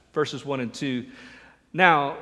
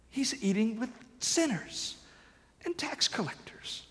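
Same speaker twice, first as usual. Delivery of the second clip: higher, hushed gossip, breathed out; scandalized whisper; 7 semitones higher, 8 dB quieter than usual. Retold or imitated. Preacher voices Pharisees gossiping about Jesus' dinner guests, hushed. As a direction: whisper the scandal behind a hand. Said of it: "they grumbled".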